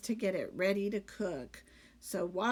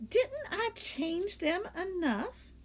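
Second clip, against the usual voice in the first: slow, innocent maid voice; 9 semitones higher, slower than usual. Acted. Amelia Bedelia character voice.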